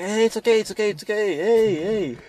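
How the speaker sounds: high, loud soothing "it's okay" to a fallen man; calming coworker. Retold or imitated. A coworker soothing the man who collapsed, high and loud. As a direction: coax him gently but loudly.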